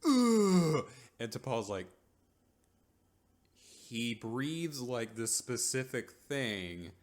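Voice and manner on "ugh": high, loud "ugh"; exaggerated cry of outrage; mock furious groan. Trip loses it.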